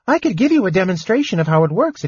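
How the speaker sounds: high, fast, tumbling offer; eager. Acted. Tass eagerly offers the gnome a demonstration, high and fast.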